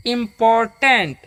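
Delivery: important slowly, as "im-pot-tent"; syllable-by-syllable reading. Shown as a demonstration. Teacher reads a big word for students, syllable by syllable. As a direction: stretch important into three separate beats. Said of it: "तो इसको कैसे पढ़ेंगे".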